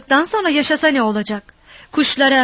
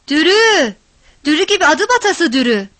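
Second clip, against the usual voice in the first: high, loud exasperated yell; calling out; 4 semitones higher, 5 dB louder than usual. A narrator's reading. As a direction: shout the name, cursing her. Frantic calling for the girl.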